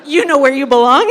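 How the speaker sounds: fast, loud, laughing jeer; gloating rich man, laughing; smug taunt. Acted. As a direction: sneer it down at the beggar.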